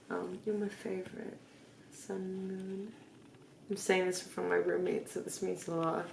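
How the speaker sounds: very soft, low murmur; tender sign-off, drifting toward sleep; quiet, sleepy tenderness. Real, not acted. Drunk birthday message to a loved one before sleep, quiet and tender.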